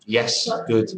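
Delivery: ordinary delivery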